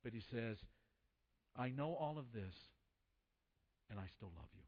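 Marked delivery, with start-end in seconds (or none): 1.6-4.6 soft, low, sincere retelling; tender declaration. Retold or imitated. Robin Williams's character reassures his date, tenderly and quietly. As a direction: let it drop low and gentle, with a pause.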